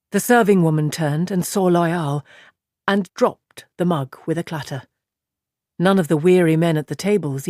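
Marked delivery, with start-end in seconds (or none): none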